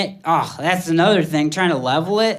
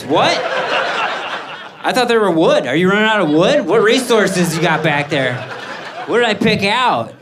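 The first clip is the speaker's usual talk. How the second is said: mock panic; 3 semitones higher than usual. Customer reacting to the frame price, in mock panic. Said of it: "he could see I was panicked".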